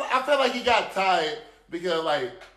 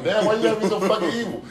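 mock complaint; 3 semitones higher than usual. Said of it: "I'm like".

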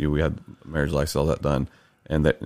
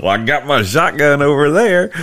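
put-on drunk judge; 10 semitones higher, 7 dB louder than usual. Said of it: "He goes".

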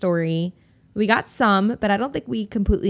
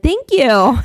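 loud, higher mock thank-you; imitated gushing thanks; 5 semitones higher, 10 dB louder than usual. Friend answering a kid's compliment on her bangs, imitated gushing thanks. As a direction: play the flattered friend, louder and higher.

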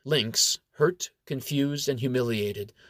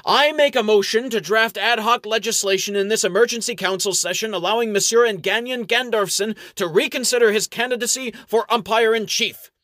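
higher, louder grand proclamation; bolder bombast; 9 semitones higher, 5 dB louder than usual. Acted. Toadley boldly proposing the new law to the council. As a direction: swell it up like a rallying demand. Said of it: "make Toadley even bolder".

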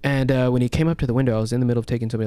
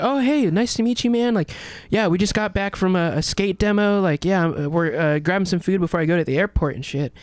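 much higher, easygoing introduction; imitating laid-back skater; 7 semitones higher than usual. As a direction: introduce him like a relaxed pal. Skater friend replies, voiced.

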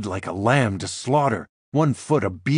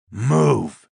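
growling; 3 semitones higher, 5 dB louder than usual. Acted. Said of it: "he growls".

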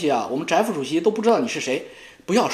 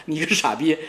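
ordinary talk, nothing marked

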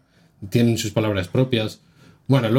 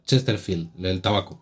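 normal talking voice

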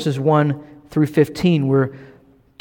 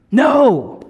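emphatic no; 7 semitones higher than usual. Retold or imitated.

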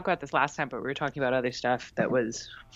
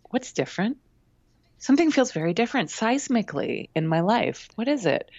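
wondering inner voice; 7 semitones higher than usual. Retold or imitated. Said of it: "I had a moment of, like".

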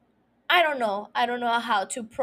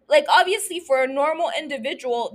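ordinary delivery

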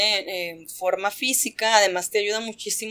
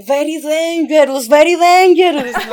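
put-on higher, louder, slow Russian-accented awe; mock Russian awe; 6 semitones higher, 11 dB louder than usual. Belarusian guy after the wrist lock, slow louder mock Russian awe. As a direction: say it slowly in thick Russian English, impressed. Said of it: "se me queda viendo y me dice".

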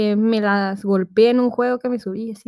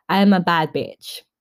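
mock tough swagger; 5 semitones lower, much slower than usual. Mock tough self-talk.

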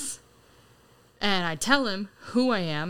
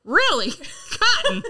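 much higher, louder, laughing mock delight; amused celebrity; 8 dB louder, slower than usual. Retelling Liev on the phone, laughing high. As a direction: squeal it out like a tickled star. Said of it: "He goes".

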